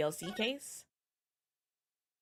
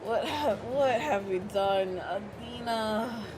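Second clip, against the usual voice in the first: higher, slow wailing apology with a broken laugh; over-the-top weepy confession; hammed-up sobbing; 6 semitones higher, much slower than usual. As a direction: wail it out like a detective falling apart. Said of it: "stop your crying".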